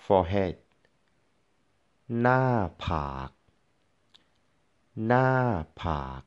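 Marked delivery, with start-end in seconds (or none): 2.2-6.2 หน้า falling, then ผาก low. Shown as a demonstration. Teacher modelling หน้าผาก for learners, falling and low tones. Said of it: "falling and low tone".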